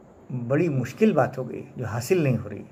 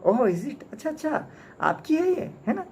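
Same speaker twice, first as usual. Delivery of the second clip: high, sneering "oh, is it"; mocking sneer; 12 semitones higher than usual. Soapy sneering at the umbrella owner, high and mocking. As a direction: tease him like a cheeky thief. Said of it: "बड़ी तंजिया हंसी हंसा".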